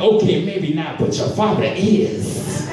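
the friend insists, in a mock African accent. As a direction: slyly concede in an African accent. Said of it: "he comes up".

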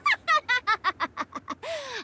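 high gleeful cackle; mocking cackle. Nara laughs at her frightened sister, mocking cackle.

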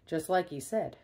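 usual speaking voice

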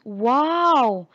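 loud, delighted wow; amazed wow. Acted. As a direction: gasp it out like an amazed kid. Teacher voicing amazed friends.

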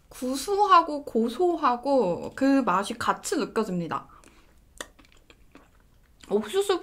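muffled eating speech; savoring ice cream while talking; talking with mouth full. Real, not acted. Seen 1: Just took a spoonful; speaks with closed, puffed lips while savoring.

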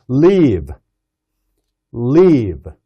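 "Live" with a tight, long "ee" from the throat; tense long ee. Shown as a demonstration. Teacher showing a tense, long "ee" in "Live". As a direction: press "Live" into a tense "leeve". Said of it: "리 하면서 이 목에 훨씬 더 긴장이".

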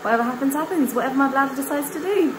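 breezy, resigned sing-song; carefree. Real, not acted. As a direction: toss it off with a shrug. Shrugging off exam nerves.

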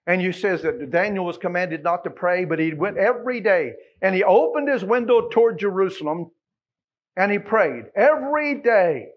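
loud, high, ringing proclamation; fervent revival preaching; preaching at full force. Acted. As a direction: build the prophecy into a shout.

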